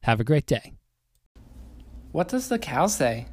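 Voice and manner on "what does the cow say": gentle sing-song prompting. Real, not acted. Parent prompting toddler at home.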